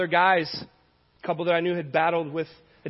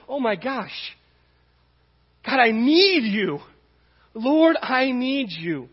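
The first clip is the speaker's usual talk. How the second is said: slow, earnest plea to God; heartfelt, slow surrender; slow prayer; 5 semitones higher, much slower than usual. Retold or imitated. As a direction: pray it out slowly, pleading. Retold moment of surrender.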